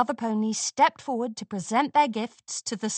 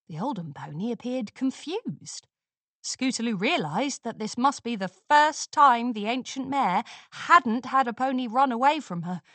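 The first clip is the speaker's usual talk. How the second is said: plain reading voice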